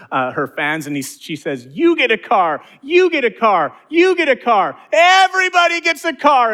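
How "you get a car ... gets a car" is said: high, loud, giddy giveaway shouts building; excited Oprah shout. Retold or imitated. Preacher imitating Oprah to the congregation, excited shouting. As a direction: shout it like a game show host giving out cars. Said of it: "she gets really excited".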